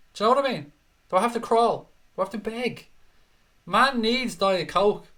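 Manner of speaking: high-pitched comic begging; playful, whiny-high wheedling; mock pleading. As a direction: plead hammily for a favour. Joking plea to Coca-Cola for sponsorship.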